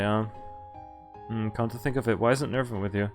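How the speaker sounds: ordinary talk, nothing marked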